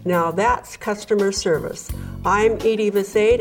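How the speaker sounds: ordinary delivery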